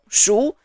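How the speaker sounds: shu with a rising pitch; second tone. Shown as a demonstration. Teacher showing learners the second tone, rising. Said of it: "the syllable with the second tone".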